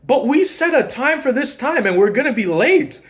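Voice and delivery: high, pleading protest; exasperated plea. Retold or imitated. Urging partner in bathroom, high exasperated plea. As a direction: push back, exasperated and high.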